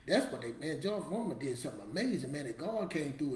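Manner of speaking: normal talking voice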